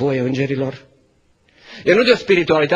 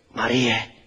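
soft, low, tender naming; tender call; 9 semitones lower, 6 dB quieter than usual. Acted. The risen Jesus gently calls Mary by name.

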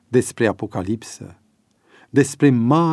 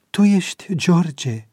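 higher, slow, moved question; moved, emotional; 8 semitones higher, 4 dB louder, slower than usual. A narrator's reading. Avi's wife recognising George on the phone, moved. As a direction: ask it higher and slower, with feeling. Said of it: "întrebă ea emoționată".

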